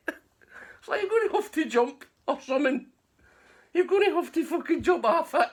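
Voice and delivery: high, loud, cracking up; laughing through it. Retold or imitated. Teller tells Bones to jump while laughing loud. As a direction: try to give advice while laughing.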